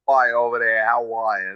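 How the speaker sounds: lightly put-on New York twang; New York accent. Singer shows interviewer his catchphrase, New York accent. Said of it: "They had a New York accent".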